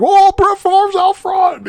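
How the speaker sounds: high, loud, drawn-out mock announcer call; excited commentator's shout. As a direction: shout it like a stunned play-by-play guy. Acts out startled commentator's excited call.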